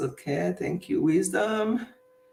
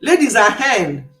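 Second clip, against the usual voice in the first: ordinary talk, nothing marked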